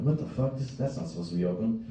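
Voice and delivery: soft, uneasy under his breath; hushed confusion. Retold or imitated. Alone in a dark restroom, a soft uneasy mutter. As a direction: mutter it like something is wrong. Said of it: "I'm like".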